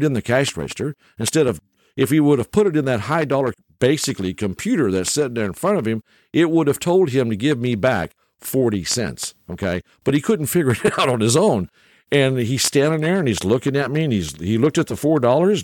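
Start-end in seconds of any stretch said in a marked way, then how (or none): none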